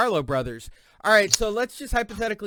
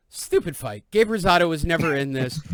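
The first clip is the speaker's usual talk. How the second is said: ordinary talk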